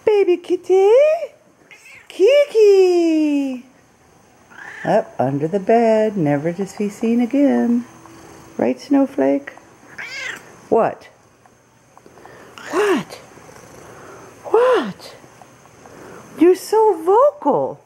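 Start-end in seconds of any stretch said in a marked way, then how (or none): 0.0-3.6 much higher, cooing cry; high excited call to the cat; excited kitty call. Real, not acted. Calling to the cat. 8.6-17.8 higher cooing questions to the cat; high sing-song replies to a meowing cat; pet talk. Real, not acted.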